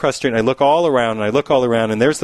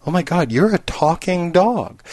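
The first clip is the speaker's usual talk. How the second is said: slow, dawning astonishment in raised pitch; mock amazement; 7 semitones higher than usual. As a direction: draw out the disbelief. Bartender's slow realization in joke.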